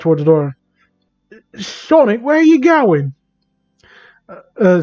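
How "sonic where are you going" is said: high-pitched anxious character voice. A narrator's reading. Amy asks as Sonic walks to the door, anxious. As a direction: call it out high and worried. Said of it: "she asked while getting anxious".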